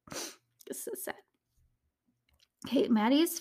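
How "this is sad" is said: softer, slow whisper through tears; barely getting the words out; real choked whisper. Real, not acted. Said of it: "I'm tearing up".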